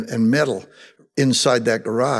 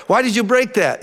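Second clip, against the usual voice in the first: scolding parent; 9 semitones higher, 3 dB louder than usual. Retold or imitated. Acted parent questioning the child.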